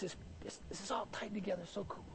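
quick, hushed whisper of delight; whispered aside. Real, not acted.

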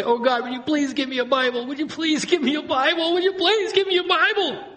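high-pitched begging that climbs with each repeat; hammed-up desperate prayer; mock pleading. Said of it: "we begin to plead with God".

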